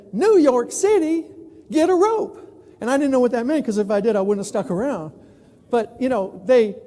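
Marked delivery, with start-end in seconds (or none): 0.1-2.4 high, loud, slow mocking Texan cry; mock Texan lynch mob. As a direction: ham up an outraged cowboy. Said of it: "the Texans would say".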